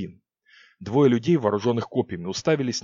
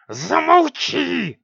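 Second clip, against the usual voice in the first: slow furious witch snarl; growled command; 14 semitones higher, 6 dB louder, much slower than usual. Acted. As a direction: snarl it out, dragging it. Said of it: "прорычала Сикс".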